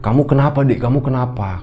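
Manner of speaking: a touch of urgent parental questioning; concerned father. Retold or imitated. Father asks crying child what's wrong, lightly acted concern.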